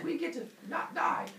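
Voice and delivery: high, happy little voices; cheering babies. Acted. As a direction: pipe up as delighted babies.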